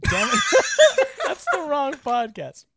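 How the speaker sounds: laughing through the words. Real, not acted. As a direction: laugh while correcting yourself. Named wrong podcast.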